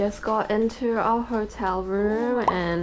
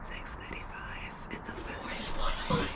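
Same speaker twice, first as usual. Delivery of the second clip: quiet breathy morning whisper; whisper, keep it hushed; 14 dB quieter than usual. Real, not acted. Early morning, others asleep.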